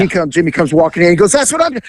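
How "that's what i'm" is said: high-pitched mock exasperation; exasperated complaint. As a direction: imitate him appealing to a witness. Retold man complaining about her.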